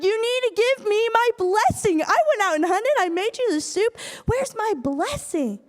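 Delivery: high-pitched wailing demand; mock bawling. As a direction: imitate a son bawling over his loss. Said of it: "Esau just starts bawling".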